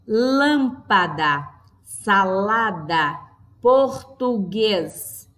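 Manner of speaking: very slow, each syllable set apart; slow teacher's syllable demo. Shown as a demonstration. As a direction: sound out three separate mouth openings per word. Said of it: "temos três aberturas de boca para a pronúncia".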